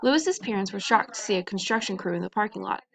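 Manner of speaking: usual speaking voice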